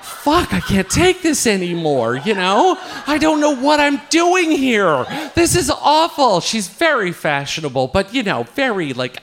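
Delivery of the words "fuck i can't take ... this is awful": high-pitched acted-out exasperation; comic cry of club misery; exasperated outburst. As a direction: vent like you're fed up with it all.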